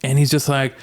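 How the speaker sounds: usual speaking voice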